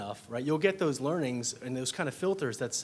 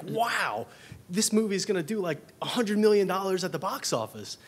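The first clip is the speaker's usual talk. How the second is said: excited; 6 semitones higher, 4 dB louder than usual. Retold or imitated. Imitating an excited first reaction.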